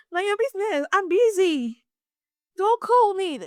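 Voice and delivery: high-pitched, playful telling-off; hammed-up annoyance at a caller; mock irritated brush-off. Acted brush-off to persistent caller.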